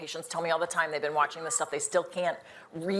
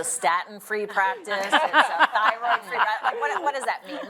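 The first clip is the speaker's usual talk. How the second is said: rising into a disbelieving laugh, higher and louder; incredulous laughing; 6 semitones higher, 5 dB louder than usual. Real, not acted. Panelist mocking a hormone-free practice, laughing in disbelief. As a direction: laugh at the absurdity, pitch climbing. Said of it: "Oh my".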